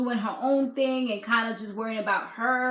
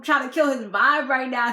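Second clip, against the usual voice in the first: ordinary talk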